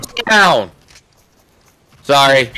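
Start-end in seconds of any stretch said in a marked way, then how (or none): 0.0-0.7 loud, high angry shout; heated yell at enemy. Real, not acted. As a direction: snap it at the enemy. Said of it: "I don't usually cuss on stream".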